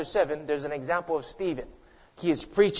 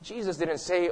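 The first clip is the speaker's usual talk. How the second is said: ordinary talk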